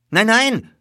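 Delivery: high, loud hasty refusal; alarmed stablehand's protest; warding off. A narrator's reading. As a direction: fend off the offered money. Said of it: "wehrte er ab".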